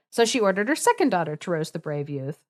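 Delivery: ordinary delivery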